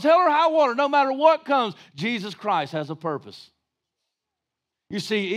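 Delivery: usual speaking voice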